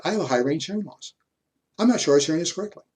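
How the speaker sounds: ordinary talk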